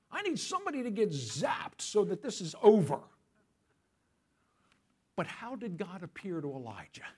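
ordinary talk, nothing marked

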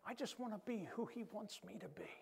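breathy whispered confession. Real, not acted. Pastor confiding his wish to the congregation, whispered. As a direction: whisper it like a vow.

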